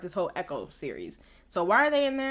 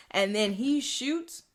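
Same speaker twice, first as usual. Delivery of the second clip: ordinary talk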